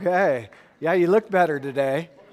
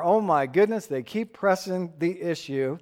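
normal talking voice

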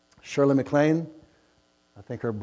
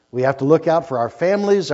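ordinary delivery

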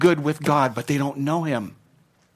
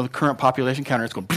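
ordinary talk

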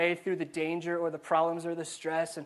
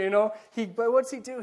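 ordinary talk, nothing marked

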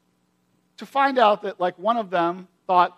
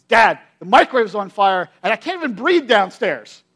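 louder, urgent son raising the alarm; retold panicked alarm; 8 dB louder than usual. Son waking dad at night, urgent loud alarm. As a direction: blurt it out like a scared teen waking his dad. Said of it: "this is what he says".

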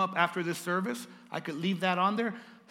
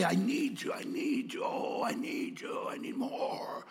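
breathy, desperate begging for more; greedy craving; 7 semitones higher, slower than usual. Pretend. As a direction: whimper for money like an addict. Mocking a greedy money-lover.

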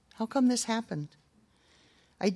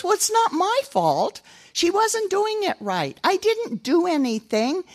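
put-on high, slow whining boy; whiny excuse; 11 semitones higher, 4 dB louder than usual. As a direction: make excuses like a sulky three-year-old. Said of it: "kind of sound that way".

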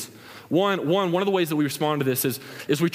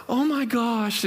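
high, rushed oh my gosh; sympathetic gasp; 7 semitones higher, much slower than usual. Retold or imitated. Preacher voicing a girl seeing her friend cry, high sympathetic exclaim. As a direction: react with instant sympathy.

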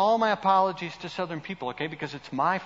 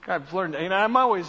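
ordinary talk, nothing marked

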